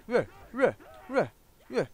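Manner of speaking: high squeaky grunting; mock zombie grunts. Gamer mocking a zombie with high grunts.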